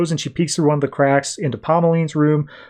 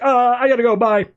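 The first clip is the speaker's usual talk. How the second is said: put-on higher, hasty nervous boy bailing out; flustered escape; 10 semitones higher than usual. Boy caught on the roof with Heathcliff, nervously bailing out. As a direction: caught out, gets away fast.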